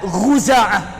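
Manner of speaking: "Khuza'ah" begun with gh instead of kh, as the tribe says it; ghain pronunciation. Shown as a demonstration. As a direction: say "Khuza'ah" with a voiced throaty gh at the start. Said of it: "pakai ghin".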